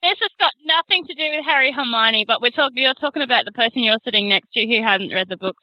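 ordinary talk, nothing marked

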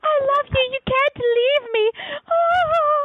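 very high, hammed-up melodramatic wail; mock grieving wife. Wife to her dying husband in a movie, mock anguished wail. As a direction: parody a weeping movie heroine in a high voice. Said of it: "guy is dying and his wife".

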